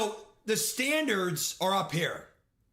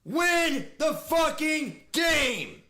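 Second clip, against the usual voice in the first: drawn-out, raised yell; furious slow shout; 4 semitones higher, much slower than usual. Real, not acted. Gamer yells at his own team after a loss, slow furious shout. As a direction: drag every word out in fury.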